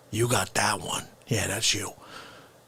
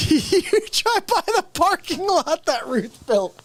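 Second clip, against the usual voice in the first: laughing through it; 9 semitones higher than usual. Real, not acted. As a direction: repeat the line cracking up, higher.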